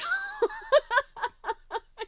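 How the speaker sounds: high, loud real laugh; bright squealing laugh; burst of laughter. Real, not acted. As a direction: crack up at the silly name. Laughs at funny quilt name.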